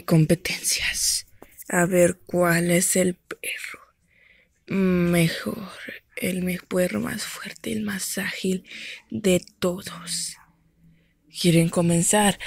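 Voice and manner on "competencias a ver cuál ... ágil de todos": soft, breathy contest host; whispered announcer. Acted. Host whispers the contest to the audience. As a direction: whisper it like a secret show.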